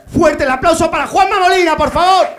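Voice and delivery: loud, high announcer call. Real, not acted. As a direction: hype the crowd for the next act. Introduces next comedian.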